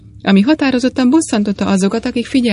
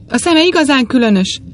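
put-on a young woman's agitated cry, louder; strong agitation; 5 semitones higher, 4 dB louder than usual. A narrator's reading. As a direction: cry out, deeply upset. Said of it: "kiáltott fel a fiatal nő erős felindulással".

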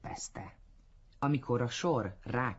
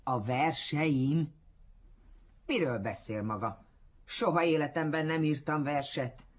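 indignant; 4 semitones higher, 4 dB louder than usual. A narrator's reading. Offended man correcting his admirer, indignant. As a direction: bristle with indignation at the mistaken praise. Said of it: "szinte gyilkolni tudott volna egyszeriben kilábalhatatlan fölháborodásában".

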